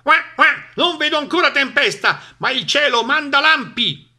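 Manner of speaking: high, loud quacking duck voice; shouting duck. A narrator's reading. Duck warns the animals of the wind, high shouting voice. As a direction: cry it out as a squawky cartoon duck. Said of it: "Di nuovo Papera gridò".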